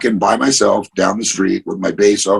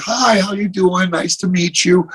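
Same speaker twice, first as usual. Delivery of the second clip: put-on gentle greeting to a child; 10 semitones higher than usual. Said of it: "I get down on my knee ... I'm like".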